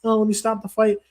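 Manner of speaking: high-pitched mocking referee voice; fussy little referee. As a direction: mimic a prancing, fussy official.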